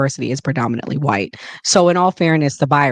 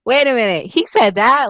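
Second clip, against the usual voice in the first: raised-pitch double take; surprised disbelief; 7 semitones higher than usual. Retold or imitated. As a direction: catch yourself mid-defense, stunned. Retold shock at his quote.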